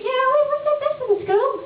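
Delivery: high mock son; son's voice. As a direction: pipe up like a proud kid.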